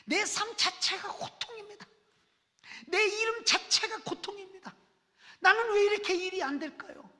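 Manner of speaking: high, loud, pleading lament; anguished crying out. Acted. Jabez crying out his suffering to God, high and loud. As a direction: wail your pain to God from the cliff edge. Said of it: "라고 울부짖는 거예요".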